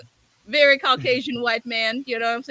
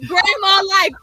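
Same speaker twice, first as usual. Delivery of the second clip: loud, high mock protest; retold outburst; 9 semitones higher, 6 dB louder than usual. Wife mocking the making of the pie, loud protest. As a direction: re-enact a baffled outburst at full pitch.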